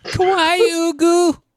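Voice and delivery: squeaky high anime fan squeal; excited anime fan. Pretend. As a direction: gush like a cutesy anime fan. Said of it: "I never want to do that again".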